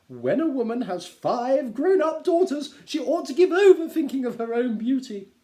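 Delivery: coy giggle through it; flattered Mrs Bennet. Acted. Wife flattered by her husband, high and simpering.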